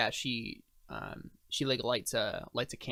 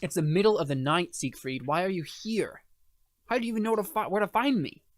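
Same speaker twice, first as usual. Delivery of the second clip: higher, insistent woman's voice; a higher, pressing NPC voice; exasperated NPC woman; 6 semitones higher, 3 dB louder than usual. Acted. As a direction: demand answers at midnight, pitched up. NPC questions late visitor.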